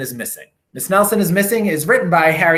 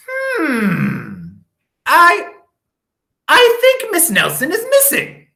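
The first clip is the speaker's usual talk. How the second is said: dim detective; 14 semitones higher, 5 dB louder than usual. A narrator's reading. Bumbling detective voiced.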